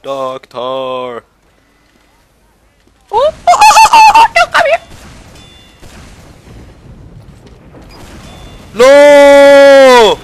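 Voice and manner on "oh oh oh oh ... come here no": very high, loud terrified squeal; panicked shriek. Real, not acted. Gamer shrieks very high as an enemy charges him.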